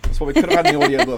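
high, fast, loud gushing; excited gushing. Real, not acted. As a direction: rattle it off in excitement. Excited gushing about audiobook.

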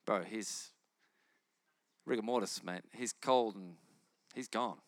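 low, dismissive mock mourner voice; skeptical mourners. Mourners telling Jesus Lazarus is dead, low and dismissive. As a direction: scoff like a know-it-all friend. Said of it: "Everyone's like".

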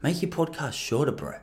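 quiet, confidential gangster impression. Comedian pulls a mate aside to advise him, hushed gangster voice. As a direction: imitate a gangster giving advice under his breath. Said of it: "I made him sound probably a little more gangster".

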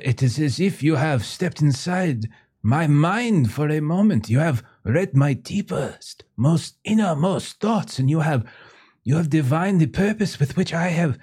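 put-on high, rapt French accent, slowing on the big words; French merchant, awed. Artist telling the buyer he read his thoughts, high rapt French accent. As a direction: gush in a French accent as if your mind was read.

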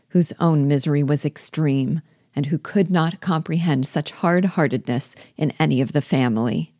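plain reading voice

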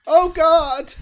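loud high shocked yelp; shocked outcry. Real, not acted. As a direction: cry out in disgust. Reacting to pants unbuttoning.

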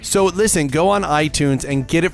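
upbeat plug; excited promo. Acted. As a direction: pitch the single like a radio spot. Plugging his single.

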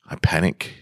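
breathless frightened whisper; panicked whisper. A narrator's reading. Narrator whispers his panic in the dark. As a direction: whisper it as panic takes hold.

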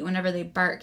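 usual speaking voice

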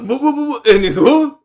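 high, loud alarmed whoa. Retold or imitated. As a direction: stop everything in alarm. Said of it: "mid-sentence, like".

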